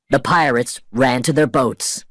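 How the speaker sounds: usual speaking voice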